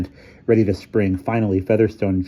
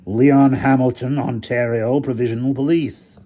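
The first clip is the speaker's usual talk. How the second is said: character voice; 5 semitones higher, 4 dB louder than usual. A narrator's reading. Hamilton voiced.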